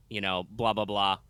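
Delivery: ordinary delivery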